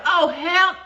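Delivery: sudden high blurt; blurted out in shock; oh hell. Retold or imitated. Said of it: "from being like".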